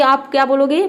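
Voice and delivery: ordinary delivery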